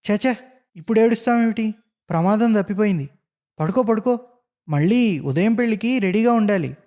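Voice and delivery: lively fussing friend; brisk comforting. A narrator's reading. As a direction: hurry him to lie down. Friend hushes crying Ramachandram.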